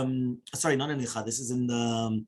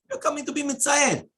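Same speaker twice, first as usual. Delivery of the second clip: mock scolding; 11 semitones higher, 4 dB louder than usual. Scolding the tactless comforter.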